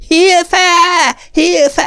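very high, loud terrified scream; screaming for help. A narrator's reading. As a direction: shriek for help in panic. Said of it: "hölgysikolya". (rémült sikoltás segítségért)

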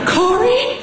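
calling out. Acted. Mother calls from below.

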